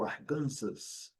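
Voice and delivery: Arkansas said the Brazilian way, ar-KAN-sas; Brazilian pronunciation. Shown as a demonstration. Teacher shows what any Brazilian would say, Portuguese-style Arkansas. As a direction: say Arkansas with a voiced final S, stress on KAN. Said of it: "Qualquer brasileiro vai dizer isso".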